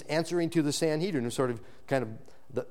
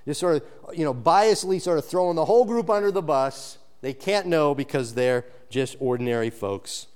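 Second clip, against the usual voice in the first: ordinary talk, nothing marked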